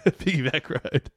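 the phrase repeated through a laugh; laughing echo. Real, not acted. Co-host repeating the phrase with amusement, laughing.

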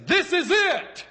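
Adam bursting out in delight at first sight of her; excited exclamation. Retold or imitated. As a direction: shout it like a man who found what he was missing. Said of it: "exclaimed Adam".

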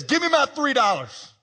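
demanding. Retold or imitated. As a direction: shake him down for three dollars.

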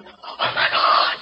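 whispered gasp of shock; breathless whispered oh my God. Retold or imitated. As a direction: hush it out, scandalized. Shocked at the kiss.

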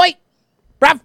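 very high, very loud short yelps; mock British yob. Host clowning with British slang, high loud mock yob calls. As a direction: squawk it like a cartoon British lad.

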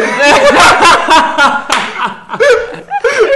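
loud, high burst of laughter; high cackling laugh, real; big laugh. Real, not acted. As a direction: crack up loudly mid-story. Laughing while telling stage story.

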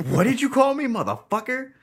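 high-pitched, hammed-up indignant comeback; mock outrage. Host acting out the fist brawl reply, high mock outrage. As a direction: play offended, voice shooting way up.

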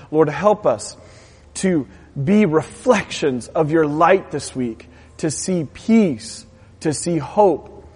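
earnest spoken prayer to the Lord; closing prayer. Acted. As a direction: pray it over the congregation.

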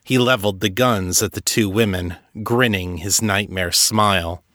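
ordinary delivery, nothing marked

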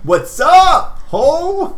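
very high, loud silly greeting; squeaky Skid voice. Acted. Skid greeting his friend, very high and loud. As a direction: pitch way up like a goofy kid.

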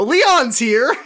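high, loud announcement; excited exclamation. Real, not acted. Reader greets Leon's appearance, excited exclamation. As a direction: burst out with the surprise. Delighted interruption of the story.

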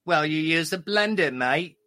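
comic English impression; English accent. Russian comic imitating English folks telling a joke, English accent. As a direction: tell it as a mock English club joke. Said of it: "Or, or the English folks".

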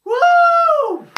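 excited whoop; loud high cheer. Real, not acted. Cheering the end.

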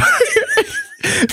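very high burst of laughter; hysterical laughter. Real, not acted. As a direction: explode into squealing laughter. Reader cracks up.

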